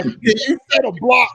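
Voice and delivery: ordinary talk, nothing marked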